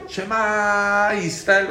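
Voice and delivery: mock yeshiva cry; yeshiva-style shout. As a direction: call it out like a yeshiva hall. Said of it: "se grita".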